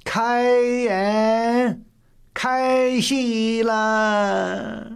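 high, long-drawn herald's call; loud announcement. A narrator's reading. Attendant announcing the opening of the opera, loud drawn-out call. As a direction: call it out high, stretching the syllables. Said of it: "高乌庸立刻高声道".

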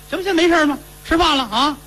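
put-on high, happy old woman's voice; delighted old lady. Grandmother relieved her grandson eats again, high and delighted. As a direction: bubble over with relief. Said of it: "老太太也高兴".

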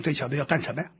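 low, hushed wondering; inner thought. A narrator's reading. Station chief wonders about the visitor, low suspicious inner question. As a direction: think it privately, low and soft. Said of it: "郭燕心想".

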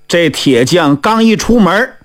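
ordinary delivery, nothing marked

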